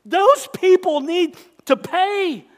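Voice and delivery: slow, raw outraged shout; worked up. Real, not acted. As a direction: let the anger burst out. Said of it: "Sorry, I'm getting worked up".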